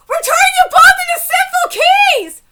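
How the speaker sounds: high, very loud incredulous repeat; screamed outrage, pitch way up; outraged shout. Real, not acted. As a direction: yell it back in disbelief. Outraged repeat of line just read.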